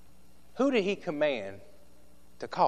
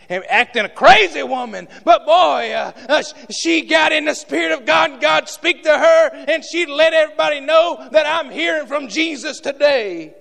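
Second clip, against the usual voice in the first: loud, high ringing preacher's cadence; fiery preaching; 15 dB louder than usual. Acted. Preacher describing the lady in the Spirit, shouted high. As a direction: build it up and shout it out.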